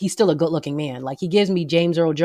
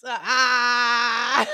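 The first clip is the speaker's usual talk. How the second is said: [name] yell; 7 semitones higher than usual. Retold or imitated. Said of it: "I'm saying it like [name]".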